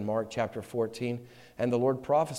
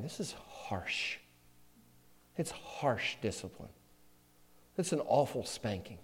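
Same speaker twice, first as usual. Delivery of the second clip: hushed, whispered complaint; whispered aside; 10 dB quieter than usual. Retold or imitated. Recalling a father's discipline, whispering. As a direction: murmur it like a boy's secret grievance.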